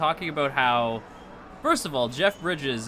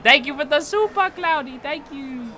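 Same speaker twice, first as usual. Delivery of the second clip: 1 bright thanks; 12 semitones higher, 5 dB louder than usual. Real, not acted.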